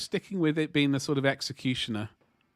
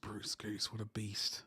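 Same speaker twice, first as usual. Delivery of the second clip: breathy whisper of awe; hushed, impressed whisper; awed whisper; 5 semitones lower, 13 dB quieter, slower than usual. Real, not acted. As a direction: whisper it as if stunned by the size. Awed comment on the aircraft.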